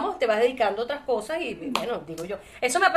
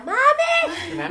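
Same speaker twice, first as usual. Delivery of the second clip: put-on high, loud call of a little girl; retold child's shout; 12 semitones higher, 4 dB louder, much slower than usual. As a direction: shout mami like a child across the crowd. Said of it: "Y ella me grita".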